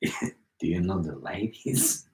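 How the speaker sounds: sidle up with a soft, low question; creepy sweet-talker. Acted. Creepy put-on pickup routine.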